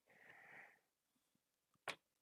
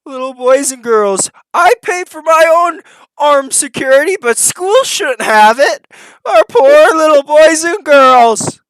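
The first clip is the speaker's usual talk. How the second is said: sarcastic mock pity; 11 semitones higher, 8 dB louder than usual. Mocking the speech about children.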